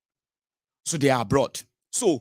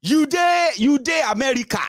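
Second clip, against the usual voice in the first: shouting back; 9 semitones higher, 4 dB louder than usual. Retold or imitated.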